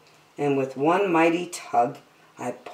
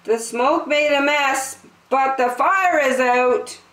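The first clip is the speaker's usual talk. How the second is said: calling out; 8 semitones higher than usual. Acted. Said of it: "called out the door with a shout".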